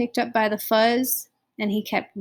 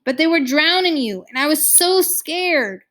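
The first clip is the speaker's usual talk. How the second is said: high, raised-voice scared confession; blurted out; 7 semitones higher, 5 dB louder than usual. A narrator's reading. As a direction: burst out pleading, pitch jumping up. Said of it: "he finally blurted out".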